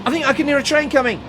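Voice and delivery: high, loud and fast, rushing the others; excited hurry-up shout; urgent hurry. Acted. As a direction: call out as if the train is already coming. Dick urging others to rush.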